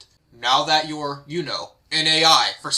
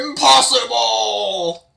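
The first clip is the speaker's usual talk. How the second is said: higher, louder, slow shocked cry; stunned villain; 6 semitones higher, 5 dB louder, much slower than usual. Acted. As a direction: drag out the shock like a beaten villain.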